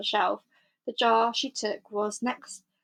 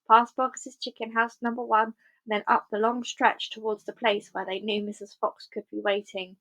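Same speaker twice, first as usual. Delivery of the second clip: ordinary delivery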